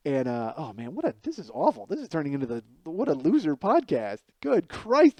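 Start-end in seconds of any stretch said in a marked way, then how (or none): 4.4-5.2 exasperated. Real, not acted. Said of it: "this is terrible".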